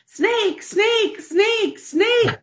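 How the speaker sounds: high frantic yell of snake; panicked screaming. Retold or imitated. Wife shrieking up the basement stairs in panic. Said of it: "screaming at the top of her lungs".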